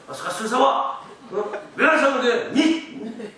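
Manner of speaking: hammed-up angry dad, high and loud; demanding father. Father sending child for liquor, mock angry demand. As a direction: imitate a dad snapping for his drink. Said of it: "막 하면서 막".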